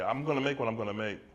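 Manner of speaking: usual speaking voice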